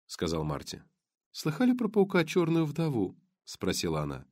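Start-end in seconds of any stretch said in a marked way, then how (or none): 1.3-3.2 lightly voiced blonde.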